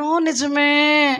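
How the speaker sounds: long drawn-out mimicked agreement; mock credulous agreement. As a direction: stretch it out like an easily convinced listener. Mimics a gullible listener agreeing.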